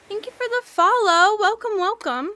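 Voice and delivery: high, loud streamer greeting; sing-song high welcome; bubbly thanks. Real, not acted. Thanking a new follower.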